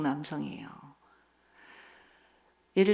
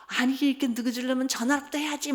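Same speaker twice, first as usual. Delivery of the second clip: higher-pitched, annoyed mother's nagging; irritated scolding; 5 semitones higher than usual. Retold or imitated. Mother to son home late at night, irritated. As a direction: imitate a worried parent snapping. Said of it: "막 이렇게 짜증을 내면".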